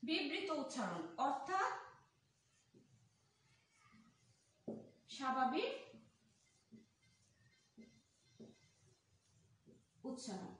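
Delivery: long gaps between phrases; slow dictation. Shown as a demonstration. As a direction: dictate in pieces, waiting for writers. Teacher dictates while writing it out.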